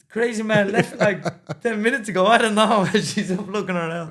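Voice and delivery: loud, high-pitched story told through laughter; laughing retelling. Retold or imitated. Retelling being found asleep at the bar, laughing loudly. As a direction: crack up while quoting the exasperated friend.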